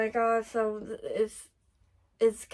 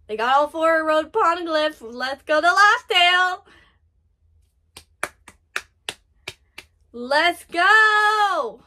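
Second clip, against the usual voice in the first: loud, raised cheer with stretched endings; triumphant cheering; 3 semitones higher, 8 dB louder, slower than usual. Real, not acted. Fan cheering the crew on to Lost Tail, triumphant shout. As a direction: cheer them on at full voice.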